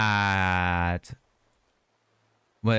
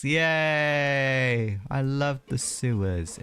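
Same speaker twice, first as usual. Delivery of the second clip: sarcastic; 5 semitones higher, much slower than usual. Acted. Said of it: "It is absolutely horrid here".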